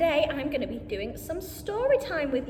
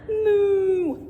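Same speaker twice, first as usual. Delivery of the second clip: cow moo; 2 semitones higher, 6 dB louder than usual. Acted. Children repeat animal noises.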